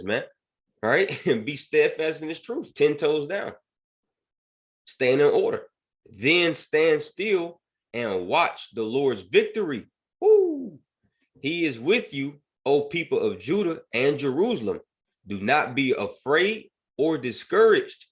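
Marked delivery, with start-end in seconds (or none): 1.3-9.9 raised, driving preacher's voice; rousing sermon delivery building to a shout; preaching. Acted. Preaching scripture with rising force. 10.2-10.8 high loud whoop of joy; excited whoop. Real, not acted. Excited shout mid-sermon. 11.4-18.1 raised, driving preacher's voice; rousing sermon delivery building to a shout; preaching. Acted. Preaching scripture with rising force.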